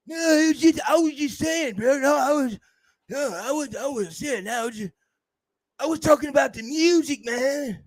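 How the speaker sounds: high, fading mock stammer; hammed-up scared excuse; scared backtracking. Imitating someone scared backtracking.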